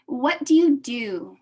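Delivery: ordinary delivery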